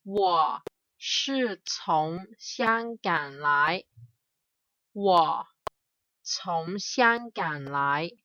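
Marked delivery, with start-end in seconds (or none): none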